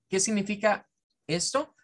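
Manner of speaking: ordinary talk, nothing marked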